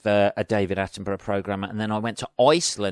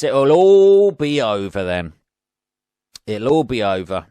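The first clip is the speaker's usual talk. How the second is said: mock ominous; 7 dB louder than usual. Dramatic sign-off before game.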